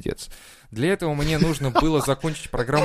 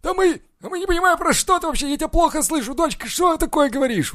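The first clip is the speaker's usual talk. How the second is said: put-on old man voice. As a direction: play a deaf old man acting confused, voice pitched way up. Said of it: "типа, старики".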